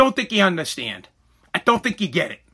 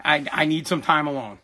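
subdued, upset; 7 semitones lower, 6 dB quieter than usual. Real, not acted. Dad asking child for time alone, quietly upset. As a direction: keep the upset contained, voice lowered. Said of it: "Because Daddy's upset".

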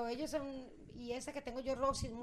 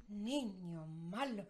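very slow, low sinister voice; mock-evil, low and drawn-out; diabolical parrot; 4 semitones lower, 6 dB quieter, much slower than usual. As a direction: drag it out like a possessed bird. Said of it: "O diabólico".

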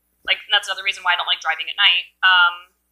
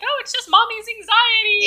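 put-on high singsong child voice; mock-dismissive kiddie lilt; joking kids impression; 14 semitones higher than usual. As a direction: imitate kids teasing their mom. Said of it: "joke about it".

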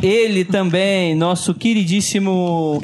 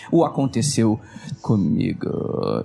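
dramatic announcer; 10 semitones lower, slower than usual. Acted. Show segment title announced dramatically.

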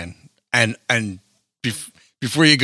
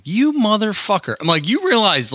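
high, indignant outburst; exasperated yelp at a careless driver; acted road rage; 12 semitones higher, 3 dB louder than usual. Driver cursing whoever pulled out in front, exasperated.

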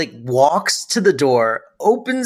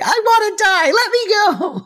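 high, wailing mock despair; mock dying old mother. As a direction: ham up a melodramatic mother begging to die. Retelling Sylvia's melodramatic line.

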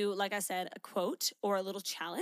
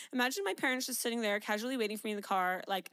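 usual speaking voice

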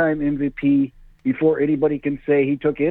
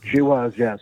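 ordinary delivery

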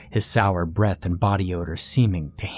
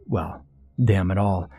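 ordinary delivery, nothing marked

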